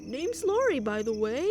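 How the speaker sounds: lightly voiced chatty girl; friendly neighbor sister. Sister introducing herself to the new neighbor, lightly voiced and friendly. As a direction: greet the newcomer in a lightly put-on voice.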